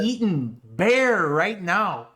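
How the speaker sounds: mock Toronto roadman slang, dragged out; imitating the Toronto accent, slow and slangy. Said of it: "the ugly Toronto accent where they're like".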